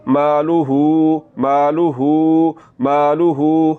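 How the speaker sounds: "मालूहू" slow, with a long-held "hoo"; lengthened final hu. Shown as a demonstration. Teacher shows inverted pesh, long ending on मालूहू. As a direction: draw out the last vowel of "मालूहू". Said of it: "इसको लंबा करना है".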